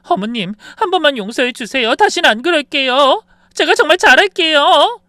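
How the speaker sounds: very high, loud tearful begging; crying plea. Acted. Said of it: "유나가 울면서 말했어요".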